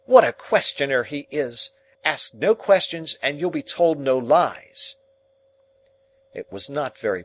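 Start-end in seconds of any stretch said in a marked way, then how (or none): 0.0-5.0 higher, louder scolding; frowning scold. Acted. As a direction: scold the boy sharply. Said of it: "frowning at me over her work".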